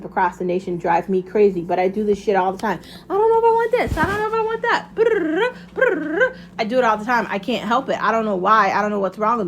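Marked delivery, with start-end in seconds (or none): 3.1-4.9 high-pitched mock dithering voice; hammed-up fretting, way up high; mock indecisive. Acts out her own indecisiveness. 5.0-6.4 high trilled brrr; exaggerated high-pitched sputter of indecision; mock dithering noise.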